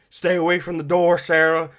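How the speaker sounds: a gruff, pushed Southern Joel warning Sarah; Joel impression. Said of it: "when I try to emulate Joel".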